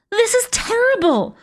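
put-on high-pitched child's wail. A narrator's reading. Upset girl hears the witches' plan, wailing. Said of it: "wailed Pink".

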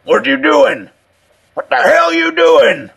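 high, hoarse, frantic shouting; hoarse frantic demand. A narrator's reading. Old man demands answers behind Richardson, frantic and hoarse. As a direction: demand it at a panicked yell. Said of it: "A hoarse, frantic voice behind him demanded".

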